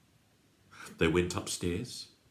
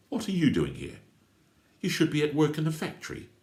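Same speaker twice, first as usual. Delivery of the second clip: loud, higher and rushing; indignant outburst; 11 semitones higher, 5 dB louder, faster than usual. Acted. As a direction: rise in pitch as the outrage builds. Uncle confronts the crooks.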